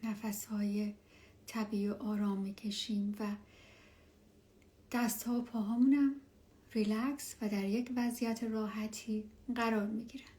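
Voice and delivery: hushed and calm, guide them down; spa whisper. Acted. Leads a meditation.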